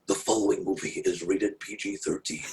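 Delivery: low, soft trailer announcer voice; movie trailer voice. Retold or imitated. Teasing his wife on a date in a low movie trailer voice. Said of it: "my wife hates this voice".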